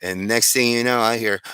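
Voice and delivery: ordinary delivery, nothing marked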